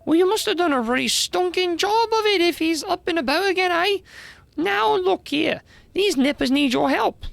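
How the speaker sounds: high-pitched, pushy character voice; scolding slangy busybody. Acted. Newcomer presses old dragon to help, high and scolding. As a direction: badger him in a high, colloquial voice.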